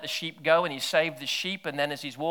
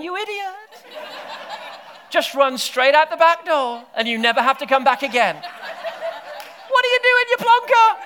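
much higher, louder, laughing self-scolding; mock panic inner voice; 11 dB louder than usual. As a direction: berate yourself through laughter. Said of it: "in my head, I'm going".

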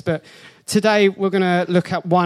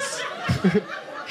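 silent laugh; 9 dB quieter than usual. Real, not acted. Laughing at own story.

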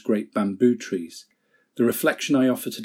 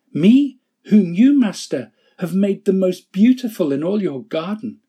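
much higher and louder, pleading in horror; horrified plea; 9 semitones higher, 6 dB louder than usual. Acted. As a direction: cry out against your fate. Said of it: "A trembling of great horror shook Bamboo".

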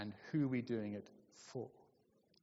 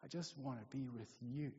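devoted whisper; 9 dB quieter than usual. Retold or imitated. Mary at Jesus' feet.

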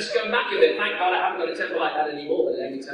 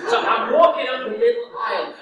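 shouting through laughter, much higher and louder; roaring with laughter; laughing shout; 6 semitones higher, 9 dB louder than usual. Real, not acted. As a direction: burst out laughing as you shout it.

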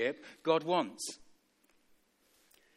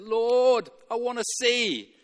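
raised, higher-pitched cry; desperate plea; 6 semitones higher, 5 dB louder, slower than usual. Acted. Blind Bartimaeus asking Jesus for sight, desperate plea. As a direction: call it out as a heartfelt plea.

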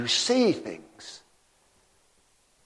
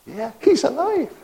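much higher and louder, with delight; joyful echo; 14 semitones higher, 6 dB louder than usual. Real, not acted. Preacher echoing Mary's news to the church, high delighted. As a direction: say it back like you can't stop smiling.

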